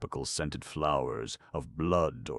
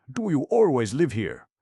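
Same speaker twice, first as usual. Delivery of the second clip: high, raised character voice for a woman's question; Kate's voice; 10 semitones higher, 8 dB louder than usual. A narrator's reading. Kate asks Don Ramon at dinner, high raised voice. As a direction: lift it well up and louder for the heroine.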